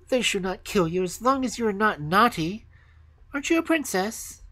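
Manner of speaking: put-on high, forceful girl's voice; high robber-girl voice, rising to a question; bold robber girl. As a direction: reassure bluntly, then ask curiously, high-pitched.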